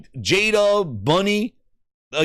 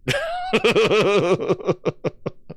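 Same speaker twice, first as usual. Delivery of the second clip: long, high-pitched cackling giggle; high wheezy laugh; 4 semitones higher than usual. Real, not acted. Streamer laughing at his own bad Spanish, giggling. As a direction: crack up at your own attempt.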